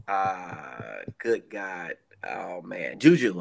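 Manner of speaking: blanking on names, stammering guesses; brain freeze. Real, not acted. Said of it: "I got a brain freeze".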